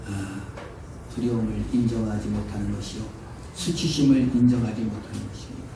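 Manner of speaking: normal talking voice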